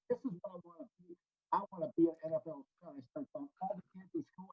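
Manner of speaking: volume drops away from the mic; quiet, leaning back from the mic; fading audio. Real, not acted. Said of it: "right when you got the book would be a good spot to pick it up".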